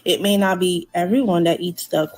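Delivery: ordinary delivery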